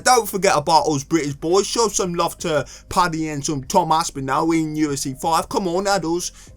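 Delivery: mock British lad accent; exaggerated British accent. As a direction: plead in an overdone British accent. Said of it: "we don't sound like that".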